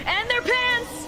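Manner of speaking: high, loud, slow triumphant shout; Gura's blurt. Retold or imitated. Gura cuts in on the streamer with a high loud gleeful blurt. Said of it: "Gura just jumps in".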